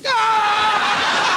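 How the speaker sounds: loud high yelp of pain; shriek. Acted. Little fella crying out as the alien pokes him, loud high shriek. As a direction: yell out as if poked sharply.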